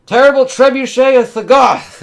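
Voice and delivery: very high, loud booming invocation; battle incantation. Acted. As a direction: roar the spell name like a war cry. Casting a spell in battle.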